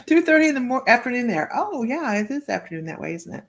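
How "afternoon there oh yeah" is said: ordinary talk, nothing marked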